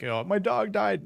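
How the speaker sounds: high, slow, put-on mournful; mock sad. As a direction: mourn it in a hammed-up way. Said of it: "it's like saying".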